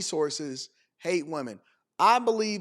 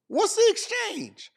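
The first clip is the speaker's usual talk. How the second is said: very high, slow, incredulous question; exasperated demand; 14 semitones higher, slower than usual. Real, not acted. Host questions her money-for-date deal, exasperated. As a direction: stretch it out high in disbelief.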